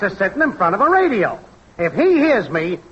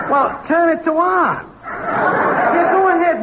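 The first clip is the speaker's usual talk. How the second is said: high, drawn-out call down the phone; exasperated yell; 7 semitones higher, slower than usual. Acted. Technician telling Max to switch his radio on, high yell. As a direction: raise the pitch and spell it out for a dope. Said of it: "what are you yelling about".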